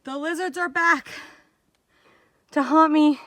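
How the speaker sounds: high, drawn-up mock-horror announcement; mock dread. Hiker announces the lizards' return, in mock dread. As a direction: play up the lizard menace, voice lifted high.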